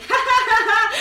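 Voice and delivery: loud, high mocking ha-ha; high, loud character laugh; acted laughter. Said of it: "He's laughing".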